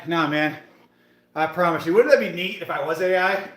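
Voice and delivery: ordinary talk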